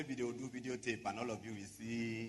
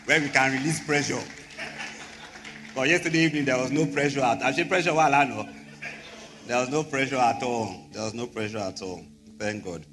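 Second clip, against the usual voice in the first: rousing preacher; 4 semitones higher, 10 dB louder than usual. Real, not acted. Pastor addresses church, rising energy.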